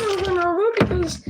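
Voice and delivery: usual speaking voice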